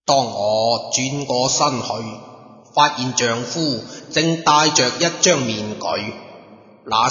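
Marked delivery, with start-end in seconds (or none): none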